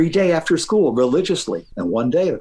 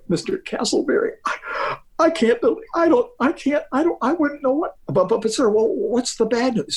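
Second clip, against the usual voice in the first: crying; 10 semitones higher than usual. Retold or imitated. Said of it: "I'm crying".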